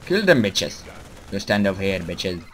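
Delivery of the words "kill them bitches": loud, raised-pitch commands to attack; frantic shouted orders; urgent battle shouts. Real, not acted. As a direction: yell your troops on in the heat of battle. Urging troops in battle.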